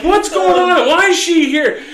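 mock-panicked retelling, high and loud; retold alarm. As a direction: demand an explanation, voice jumping way up. Retold alarmed protest at doctor.

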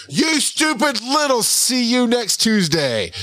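put-on high, drawn-out kid outburst; mock tantrum at a video game, slowly; imitating cursing child. Five-year-old cursing at Mario game.

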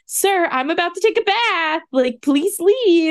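high, sing-song oblivious voice; imitating Tighnari. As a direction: hum along, then get fussy. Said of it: "Tignari's probably like".

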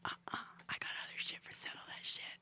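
whisper. Shown as a demonstration. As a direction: whisper it like sharing a secret. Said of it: "do a whine and a whisper".

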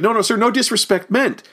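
high-pitched retold disclaimer; imitated protesting denial. Paul Frees explains himself to the offended man, imitated protest. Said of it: "Paul Frees like".

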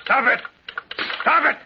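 screaming in terror to make it stop; panicked scream. Acted.

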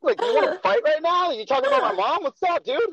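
high, loud, squaring up to fight; a South African coworker squaring up, high and loud; mock fight challenge. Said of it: "He's like".

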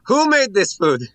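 high, booming shout across the room; loud public demand. Acted. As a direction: call out for the cook. Stands on stool, demands the cook.

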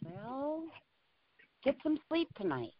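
ordinary talk

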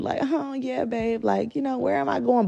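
mock-sweet, going along with it; playful indulgence. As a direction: humor her like it's cute. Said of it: "And I was just like".

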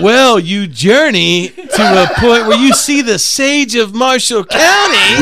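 slow, loud, grand prophet; mystic sage voice. Pretend. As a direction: intone it like a wise sage.

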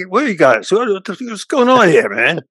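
light Rodney impression, puzzled and fussy; a hint of a bewildered Rodney; baffled Rodney Dangerfield. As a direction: toss it off like a confused Rodney who doesn't know them.

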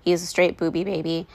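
normal talking voice

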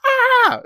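very high, loud fake scream; hammed-up child's fake scream; mock kid scream. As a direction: scream into the dark like a faking child. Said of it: "screamed into the darkness".

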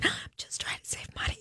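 hoarse, voiceless whisper; raspy whisper. Real, not acted. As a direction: whisper it, voice gone. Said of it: "I have no voice".

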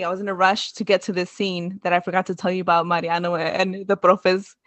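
normal talking voice

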